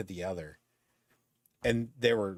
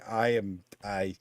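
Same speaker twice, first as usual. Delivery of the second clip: ordinary delivery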